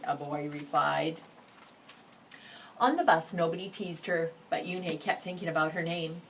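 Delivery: plain reading voice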